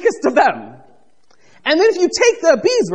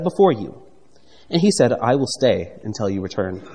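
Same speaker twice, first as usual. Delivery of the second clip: lower, measured Bible reading voice; scripture reading; 4 semitones lower than usual. Acted. Preacher reads Gideon's exchange from Judges, measured scripture reading. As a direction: read the verses aloud to the congregation.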